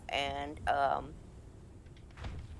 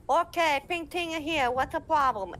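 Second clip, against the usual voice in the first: put-on high, loud accented older-woman voice; Ping Ting; 9 semitones higher, 5 dB louder than usual. Character arrives to help players, high loud accented voice. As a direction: announce yourself in a shrieky put-on Chinese accent. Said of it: "I walk up and I'm like".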